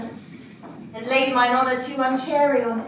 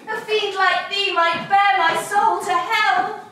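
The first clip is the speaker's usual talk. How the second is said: impassioned; 7 semitones higher, 5 dB louder, slower than usual. Acted. Olivia's parting lament.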